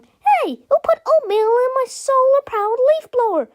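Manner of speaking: very high, loud character shout; outraged shout. A narrator's reading. Inventor finds oatmeal in the leaf blower, shouting. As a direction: yell in high-pitched outrage. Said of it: "people shouting everything from".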